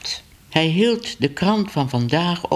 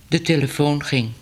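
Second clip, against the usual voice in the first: usual speaking voice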